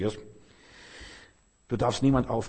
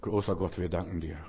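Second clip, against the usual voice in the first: casual rote prayer; 5 semitones lower, 4 dB quieter than usual. Acted. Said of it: "Das ist nicht nur".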